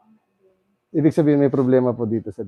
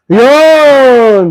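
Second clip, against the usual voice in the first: loud high cheer of triumph; big triumphant whoop; excited yell; 13 semitones higher, 15 dB louder than usual. Real, not acted. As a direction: shout it out in delight. Cheering after a loud crack.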